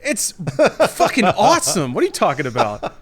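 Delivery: shocked squeak then drawn-out protest; mock outrage. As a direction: play offended at the insult. Mock outrage at game insult.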